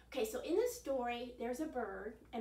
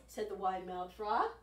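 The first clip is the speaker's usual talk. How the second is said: usual speaking voice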